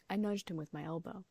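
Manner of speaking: plain reading voice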